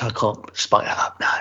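a mock whispered excuse; a hushed Tenth Doctor aside. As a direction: murmur it like a sheepish secret. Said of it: "And why his hair's not".

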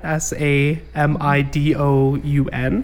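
letters spelled one by one; slow, clear spelling of each letter; spelling out slowly. Shown as a demonstration. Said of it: "samidoun dot net".

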